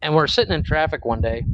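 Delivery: normal talking voice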